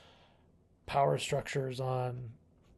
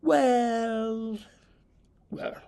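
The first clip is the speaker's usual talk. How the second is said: a much higher, drawn-out put-on well; the Doctors' well; 10 semitones higher, 4 dB louder than usual. Favorite Doctors musing aloud, hammed-up drawn-out well. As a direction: savor the word like a theatrical Doctor. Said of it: "they say".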